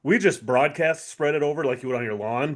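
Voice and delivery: ordinary delivery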